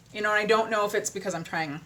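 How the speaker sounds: ordinary delivery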